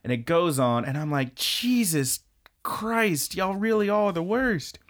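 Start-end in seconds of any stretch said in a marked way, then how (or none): 1.3-4.8 high-pitched, drawn-out mock exasperation; hammed-up disbelief, pitched up; exasperated. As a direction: groan at how hopeless these kids are. Own exasperated reaction to the rules.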